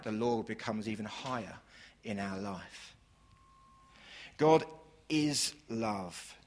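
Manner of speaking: normal talking voice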